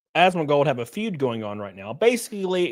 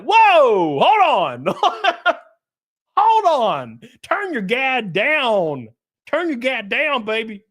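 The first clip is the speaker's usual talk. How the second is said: high, drawn-out mock plea; mock alarm; 8 semitones higher, 5 dB louder, slower than usual. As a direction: exclaim loudly and high, stretching the words through laughter. Mock calming an anxious friend.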